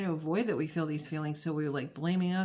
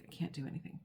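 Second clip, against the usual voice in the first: very soft, nearly whispered; freeze response; 3 semitones lower, 12 dB quieter, much faster than usual. Pretend. Demonstrating freeze, can't do anything, barely audible. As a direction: act frozen and stuck, voice dropping almost to nothing. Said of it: "And then there's freeze".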